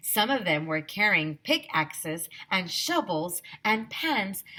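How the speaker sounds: plain reading voice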